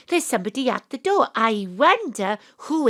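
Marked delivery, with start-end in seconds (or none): 0.1-1.3 quick, pressing repeat of the same line; hurried repeat. Acted. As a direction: rush it the second time. Panto host repeats to audience.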